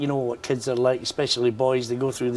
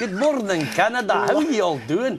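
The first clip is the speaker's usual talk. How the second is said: 1 stage host greeting; 7 semitones higher than usual. Shown as a demonstration. Demoing his on-stage banter to audience.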